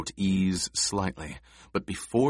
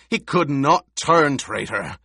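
angry insistence; 6 semitones higher, 6 dB louder than usual. A narrator's reading. Gurney snarling continues.

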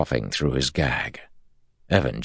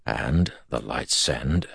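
usual speaking voice

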